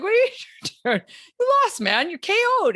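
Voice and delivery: fast, high, disbelieving voice; high incredulous. Retold or imitated. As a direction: squeak out the disbelief, quickly. Crowd telling loser he lost.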